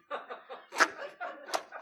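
airy high-pitched giggle; a wheezy high laugh; high breathless laugh. Retold or imitated. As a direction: imitate the mother-in-law's stifled laugh. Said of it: "She was going".

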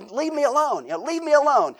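put-on high, loud childish complaint; a kid snapping at his big brother; little boy telling his brother off; irritated kid. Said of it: "I said".

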